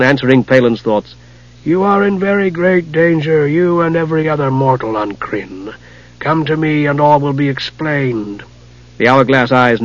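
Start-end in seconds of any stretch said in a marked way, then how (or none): 1.6-8.4 soft rasping wizard. Acted. Raistlin's voice.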